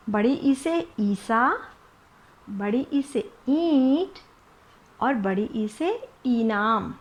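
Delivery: slow, ई stretched long in ईसा, ईंट, इनाम; teacher drilling long ई words slowly; slow long ee demo. Shown as a demonstration. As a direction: drag out each ई slowly. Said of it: "ई को लंबा खींच".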